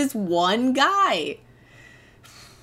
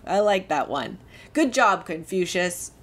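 ordinary talk

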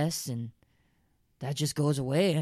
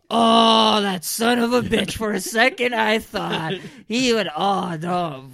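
loud, high enraged shout; furious outburst; 6 semitones higher, 10 dB louder, slower than usual. Acted. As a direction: explode in rage at him. Rage at Cameron.

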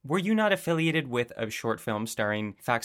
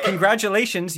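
laughing; 7 semitones higher, 5 dB louder than usual. Real, not acted. Announcing the quiz result.

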